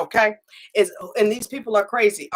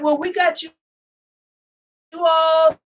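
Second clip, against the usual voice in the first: higher, louder bosses' voice; mock corporate voice, raised and outgoing; corporate bosses; 7 semitones higher, 3 dB louder than usual. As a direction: play the eager bosses calling out. Company voiced reassuring her.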